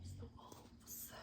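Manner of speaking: breathy whisper over the page; hushed whisper. Real, not acted. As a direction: whisper so you don't spoil the dark. Pointing at picture, hushed.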